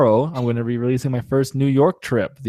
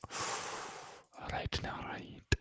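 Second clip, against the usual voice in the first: whisper; 22 dB quieter, much slower than usual. Real, not acted. Giving in to co-host's correction.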